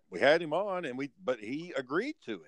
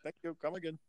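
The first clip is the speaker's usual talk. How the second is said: mock Indian shopkeeper accent, higher; Indian shop accent; 4 semitones higher, faster than usual. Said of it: "that Jamaican accent for an Indian person".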